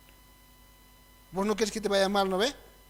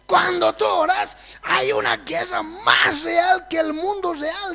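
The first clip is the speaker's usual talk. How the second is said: slow, loud, higher warning; emphatic shout; 8 semitones higher, 7 dB louder, slower than usual. Retold or imitated. Quoting the pastor's wife to the congregation, shouting. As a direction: hammer home the spiritual war. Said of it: "La pastorita dice".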